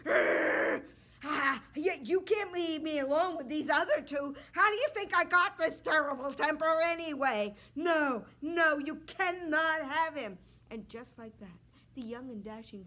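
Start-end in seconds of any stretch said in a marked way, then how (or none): 0.0-0.8 loud hammed-up growl; a princess growling at the king; angry growl. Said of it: "king with a terrible temper". 1.2-10.4 loud, higher cranky king voice; a king refusing to lose his third son; grumpy king. Acted. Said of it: "king with a terrible temper".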